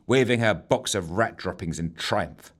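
ordinary delivery, nothing marked

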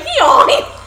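high, loud braying hee-haw through laughter; donkey bray. Retold or imitated. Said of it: "You sounded like an animal".

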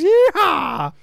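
high, loud whooping cowboy holler; cowboy yeehaw. Acted. Rounding up the boys to go vigilante, high whooped holler. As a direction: whoop it out like you're rallying a posse. Acting out a posse rallying cry.

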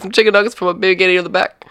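fast, high-pitched rattled-off order; rushed order. Acted. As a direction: rattle it off as the impatient customer. Acted customer repeats garbled order hurriedly.

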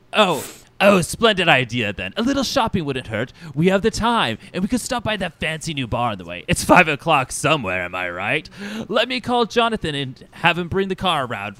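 delighted diva boss. Acted. Vain boss agreeing to go shopping, flamboyant delight. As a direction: gush with theatrical delight. Plays vain Mister Sinister.

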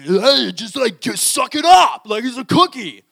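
loud, harsh "suck it up" dad; mock scolding shout. Dad snapping at his crying kid, loud mock scold. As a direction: bark it at the kid like a dismissive father.

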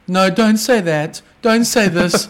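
high, slow mocking scold; mocking whiny voice. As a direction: mimic a prissy scold. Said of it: "Stupid people saying".

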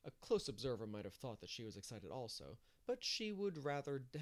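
plain reading voice

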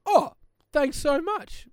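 grateful neighbour. Retold or imitated. Neighbour voiced in story.

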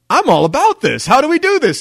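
loud, high burst of eagerness; thrilled yell in a retold story; excited agreement. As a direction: jump at the idea, loudly.

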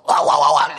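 loud mock dog barking. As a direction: bark it out loud like an angry dog. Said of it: "decía Jonás".

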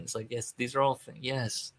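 ordinary talk, nothing marked

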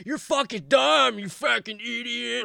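high, slow, hammed-up insult; mock drunken rant. Imagined drunken ranting at the detractor.

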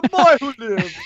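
put-on Jamaican accent. Friend reading Harry Potter aloud, hammed Jamaican accent. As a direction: ham up a Jamaican storyteller. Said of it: "just in a Jamaican accent".

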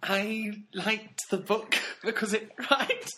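high, slow Geordie attempt breaking into laughter; failed Geordie accent. Podcaster failing at Ben's review, giggly Geordie accent. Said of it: "I can't do it".

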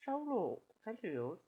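tentative British accent, starting high; faltering try at a British accent; British accent attempt. Shown as a demonstration. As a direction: have a half-hearted go at British. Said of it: "if you said it with the British accent".

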